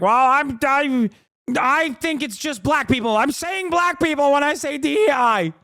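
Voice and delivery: high-pitched mocking imitation of an objector; hammed-up high-voiced indignation; mock protest. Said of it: "be like".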